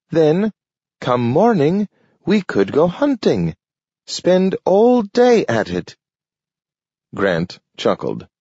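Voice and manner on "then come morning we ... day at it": a dog dreaming of a day of hunting; eager talking dog. A narrator's reading. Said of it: "he invited".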